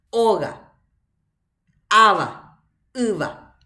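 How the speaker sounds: Oga, Aba, Iba, each punched on its first vowel; non-final stress. Shown as a demonstration. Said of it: "No final".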